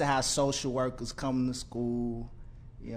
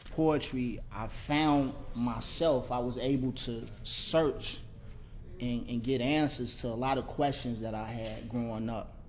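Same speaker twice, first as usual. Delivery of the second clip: ordinary talk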